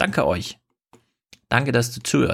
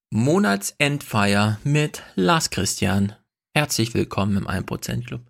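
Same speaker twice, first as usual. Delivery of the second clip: ordinary talk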